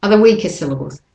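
normal talking voice

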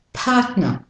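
British Australian "partner", no R; British Australian pronunciation. Shown as a demonstration. Teacher introduces partner, British Australian accent. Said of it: "the British Australian first".

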